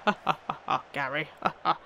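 lightly spoken mock ha-ha; mock the scorer with a sarcastic laugh. Real, not acted. Gamer mocking the opposing striker, sarcastic laughter.

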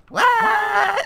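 drawn-out, extremely high, loud mock shriek; piercing squealed "what", wildly overdone; emotion voice. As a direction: shriek it in hammed-up disbelief. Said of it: "It's my emotion voice".

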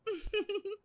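laugh. Acted. Daughter-in-law laughs.

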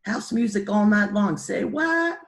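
high, loud singsong bleat; loud high-pitched burst, last word held high. Retold or imitated. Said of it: "bleated a stupid phrase out of nowhere".

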